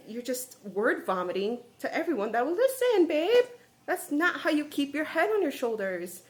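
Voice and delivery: hammed-up exasperated lecture; mock scolding. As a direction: chide a friend in playful disbelief. Mock-scolding the book's heroine.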